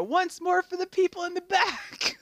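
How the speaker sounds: hammed-up hype shout; mock rally call. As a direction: call out to the back row.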